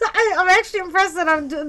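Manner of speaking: normal talking voice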